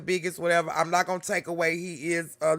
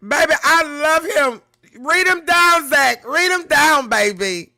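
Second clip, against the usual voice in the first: excited cheer; 7 semitones higher, 8 dB louder than usual. Real, not acted. Cheering rapper on.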